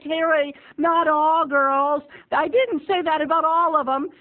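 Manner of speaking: high, slow motherly correction; patient mom voice. Retold or imitated. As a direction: gently correct your son.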